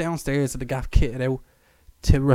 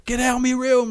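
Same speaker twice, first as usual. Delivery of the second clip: shouting; 11 semitones higher, 5 dB louder than usual. Retold or imitated. Ma voiced shouting in story.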